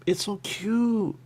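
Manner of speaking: cooing. Pretend. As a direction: coo it slowly like at a puppy. Cooing over the pastry.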